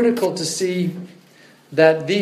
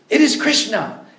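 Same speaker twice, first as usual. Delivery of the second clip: "It is Krishna" higher and louder, stress on is; forceful insistence; 8 semitones higher, 4 dB louder than usual. Retold or imitated. Prabhupada corrects the student, forceful insistence. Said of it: "speak with force".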